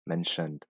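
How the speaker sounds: "mentioned" with a clear shun sound in the middle; shun ending. Shown as a demonstration. Teacher applying the ending rule: "mentioned" with shun. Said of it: "the sound is shun".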